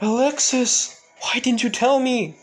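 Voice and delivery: father confronting his daughter, high and loud; anxious father. Acted. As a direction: call out to the daughter in worried disbelief. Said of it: "a man says".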